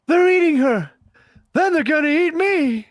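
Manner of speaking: very high, loud hammed-up terror; hammed-up horror-film scream; mock horror panic. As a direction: shriek like a B-movie victim.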